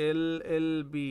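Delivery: "এল এল বি" spelled out, each letter named with its vowel sound first. Shown as a demonstration. Teacher showing students how acronyms sound, letter by letter. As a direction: say "এল এল বি" as three separate letters, "এল" starting on the e sound. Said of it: "প্রত্যেকটা উচ্চারণ করো".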